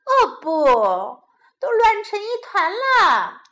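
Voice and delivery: loud, high cry of dismay; mother's hammed-up alarm. A narrator's reading. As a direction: exclaim at a terrible mess. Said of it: "cried Missus Rabbit".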